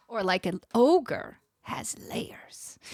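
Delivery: slow, whispery ogre; hushed ogre impression, drawn out; attempted ogre voice. Streamer joking about layers to viewers, attempted ogre voice. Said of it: "I cannot do a Scottish ogre accent".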